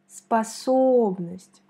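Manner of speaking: Способность slow, the second O leaned on; stress on the second O. Shown as a demonstration. Teacher isolating the stressed O for learners, slow. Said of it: "On met l'accent sur la deuxième O".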